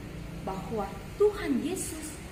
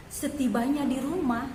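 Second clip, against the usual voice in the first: ordinary delivery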